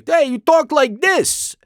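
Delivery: mock the New Jersey way of talking; New Jersey accent. A brother mimicking a New Jersey accent, playful. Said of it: "he doesn't talk like that at all".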